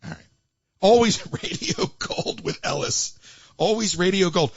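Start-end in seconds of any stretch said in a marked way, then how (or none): 0.8-3.2 breathless laugh through the sign-off; can barely get the words out for laughing. Real, not acted.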